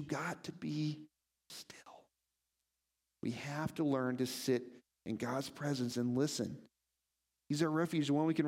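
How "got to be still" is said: soft and slow, then breathe the last word; whispered "still". Shown as a demonstration. Preacher shows stillness, ending hushed.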